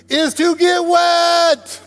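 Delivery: high, loud, drawn-out punchline; exasperated dad shout. Retold or imitated. Father to son in the pool, exasperated shout. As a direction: yell the obvious at your teenager.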